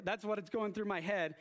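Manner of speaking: normal talking voice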